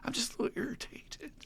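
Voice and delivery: squirm and brush it off quietly; defensive downplaying. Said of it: "And I'm like".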